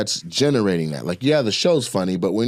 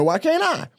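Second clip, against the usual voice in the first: mock whine. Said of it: "I'm like".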